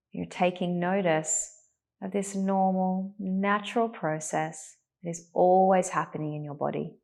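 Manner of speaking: calm, unhurried meditation voice with long pauses; guided meditation. Real, not acted. Teacher settling a class into meditation, calm and spacious. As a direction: ease them gently into stillness, leaving space to breathe.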